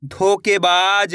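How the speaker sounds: धोखेबाज very slow, every vowel stretched; joined-up demonstration. Shown as a demonstration. Teacher blending the letters for learners, धोखेबाज slowly drawn out. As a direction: draw out धोखेबाज, especially बा. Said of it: "इस तरीके से इनको पढ़ा जाता है".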